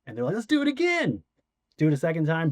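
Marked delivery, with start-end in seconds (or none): 0.3-1.2 high, eager call to go again; eager crew. Retold or imitated. Crew after the first crash, eager and high. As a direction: cheer him on for another take.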